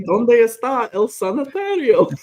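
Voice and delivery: Spanish line hammed up from a movie; movie-quote Spanish. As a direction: quote Encino Man's broken Spanish slowly. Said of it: "from Encino Man".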